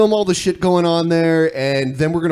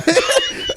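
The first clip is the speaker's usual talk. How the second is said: squeaky giggle; a sudden high laugh; real laughter; high burst of laughter. Real, not acted. Laughs at joke.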